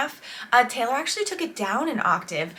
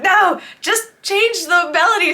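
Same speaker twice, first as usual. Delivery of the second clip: higher, louder protest at a bad jingle; exasperated objection, pitched up; mock exasperation; 9 semitones higher, 6 dB louder than usual. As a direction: cry out in playful frustration. Mock reaction to annoying commercial jingle.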